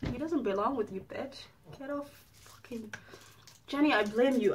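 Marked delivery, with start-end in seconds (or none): 0.1-2.9 soft, hushed cursing; quiet spiteful mutter; muttered curse. Real, not acted. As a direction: mutter it under the breath.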